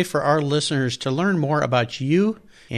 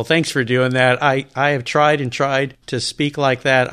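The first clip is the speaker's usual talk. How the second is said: usual speaking voice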